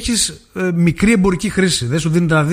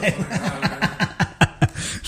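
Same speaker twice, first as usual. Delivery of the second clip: quiet breathy laugh trailing off; laughter; 4 semitones higher, 9 dB quieter than usual. Real, not acted. Host laughing at own remark, soft chuckles.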